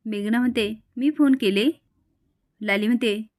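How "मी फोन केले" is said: higher, rising question with mild disbelief; surprised question. A narrator's reading. Meghna hears she supposedly phoned Lali, mildly surprised. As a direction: question the claim, lightly taken aback.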